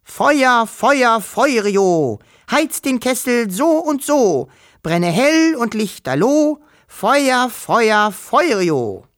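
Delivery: high, ringing chanted spell; loud incantation. A narrator's reading. Wischel summoning the dragon through the night, high loud chanting voice. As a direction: call the rhyme out high into the night. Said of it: "Laut klang Wischels Stimme durch die Nacht".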